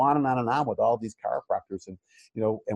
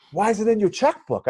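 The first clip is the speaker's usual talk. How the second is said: mentor's voice; 11 semitones higher, 4 dB louder than usual. Retold or imitated. Mentor questioning, retold phone call.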